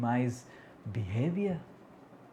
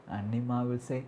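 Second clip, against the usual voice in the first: usual speaking voice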